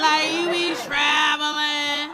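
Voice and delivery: high, drawn-out mimicry of a daughter; exaggerated, slow daughter voice; mocking daughter impression. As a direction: stretch the vowels out in a high, taunting singsong. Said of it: "This her response".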